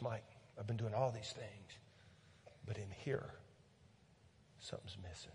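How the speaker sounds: hint of a troubled man; lightly voiced friend confessing; quiet confession. Retelling a man's confession.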